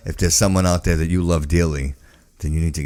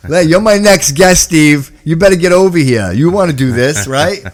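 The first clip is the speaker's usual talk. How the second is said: calling out; 7 semitones higher, 7 dB louder than usual. Real, not acted. Host calling his next guest over, loud and high. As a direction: summon him over with a loud, cheerful call.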